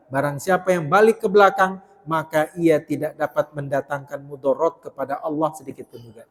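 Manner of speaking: usual speaking voice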